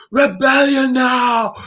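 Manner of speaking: high, slow drawn-out rallying shout; mock heroic rallying shout; battle cry. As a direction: roar it like leading a revolt. Imagined uprising battle cry.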